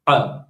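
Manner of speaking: "ha" alone, pharyngeal h pushed from the throat; strong throat-made h on "ha"; throaty ha. Shown as a demonstration. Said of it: "it comes from the throat".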